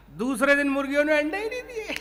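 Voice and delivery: ordinary talk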